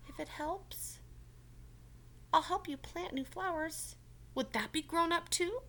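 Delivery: hopefully. Acted. Franklin offering help to Mister Mole, hopeful. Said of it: "Franklin asked hopefully".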